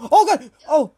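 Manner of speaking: sudden loud, high yelp; jump-scare shout, pitch leaping up; startled. Real, not acted. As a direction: cry out in real alarm. Monster suddenly gets close in game.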